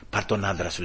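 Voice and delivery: low, soft voice of a judge; quietly advise, as a retold judge; gentle counsel. Judge sends the wife home, gentle counsel.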